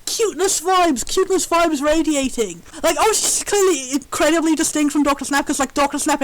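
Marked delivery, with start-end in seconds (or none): none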